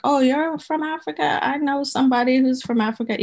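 high, eager mock American voice; teasing how Americans greet Africans, high and eager. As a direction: parody a clueless excited American. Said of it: "The way that Americans treat Africans is like".

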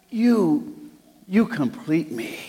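light hint of a dramatic movie line; mildly hammed-up lovestruck plea; theatrical Tom Cruise. Shown as a demonstration. Pastor demonstrates the movie line for the congregation, lightly theatrical. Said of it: "in that theatrical voice".

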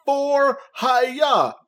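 high, sharp ninja yell; Ninja Golf battle cry. Retold or imitated. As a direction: shout it like a striking ninja. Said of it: "my Ninja Golf impression".

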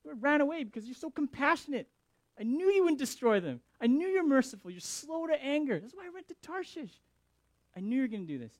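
loud, high-pitched acted outrage; angry complaint. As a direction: act out an upset protest at God. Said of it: "but anger. He's upset at God".

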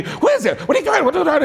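high-pitched mock interrogation; badgering wife. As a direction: come at him with questions as the wife. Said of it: "she's at me, coming at me".

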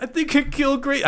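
high, whiny confession; mock panicked confession. Cohost voicing Jesse confessing, high mock panic. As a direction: blurt it like a scared kid.

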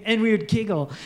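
ordinary talk, nothing marked

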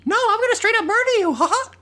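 very high, rapid gleeful threat; high-pitched playful villain with a laugh; mock cartoon threat. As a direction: squeal it fast like a murderous cartoon character. Joking acted reply to the giant.

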